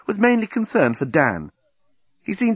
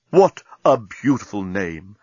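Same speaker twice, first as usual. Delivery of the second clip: slow, lower admiring compliment; gallant; 3 semitones lower, slower than usual. Acted. Captain gazing at Nettie, slow gallant compliment. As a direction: charm her gently. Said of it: "said the gallant captain".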